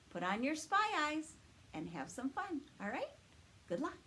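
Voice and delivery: ordinary delivery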